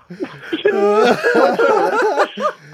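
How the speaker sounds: high laughing howl; real loud high laughter; real laughing shout. Real, not acted. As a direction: roar with laughter. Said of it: "was laughing".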